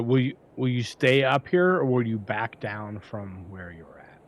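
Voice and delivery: ordinary talk, nothing marked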